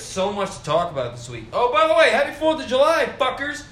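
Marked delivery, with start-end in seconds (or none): 1.5-3.6 loud, high holiday shout-out; loud greeting. Real, not acted. Vlogger yelling happy holiday to his viewers, loud and high. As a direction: yell it out to the viewers.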